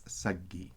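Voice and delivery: saggi with a long held double G, sag-gi; teacher models the doubled G in saggi. Shown as a demonstration. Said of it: "that double G is not marked".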